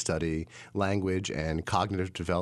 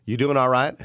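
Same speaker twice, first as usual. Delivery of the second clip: put-on louder, higher Arkansas accent, rising at the end; imitated Arkansas cashier; 4 semitones higher, 6 dB louder than usual. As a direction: imitate a local cashier's Southern greeting. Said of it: "the cashier greeted me with a".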